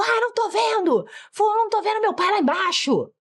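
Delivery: high-pitched mock wail of complaint; whiny, worked-up child's holler; child yelling. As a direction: imitate a frustrated kid calling out. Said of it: "a gritar alto com você".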